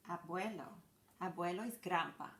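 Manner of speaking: normal talking voice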